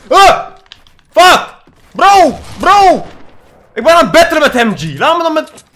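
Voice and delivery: high, loud screams in the fight; panicked yelling. Real, not acted. Gamer screaming while battling an enemy up close. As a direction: yell in panic at the screen.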